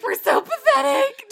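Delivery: high, slow, loud tearful whine; mock bawling. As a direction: imitate blubbering at each other. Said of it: "just bawling".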